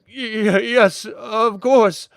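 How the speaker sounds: high, drawn-out stammered assent; shaken stammer. Acted. Shaken admiral agreeing with the guard, stammering. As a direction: stumble into agreeing, rattled.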